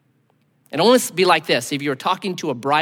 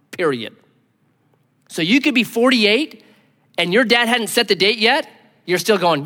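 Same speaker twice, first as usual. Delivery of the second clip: ordinary talk, nothing marked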